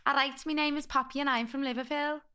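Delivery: put-on Scouse introduction; Liverpool accent. Shown as a demonstration. Introducing herself as Poppy, Liverpool accent. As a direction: introduce yourself in broad Scouse. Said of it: "we do a good Liverpool accent".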